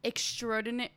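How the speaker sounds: ordinary delivery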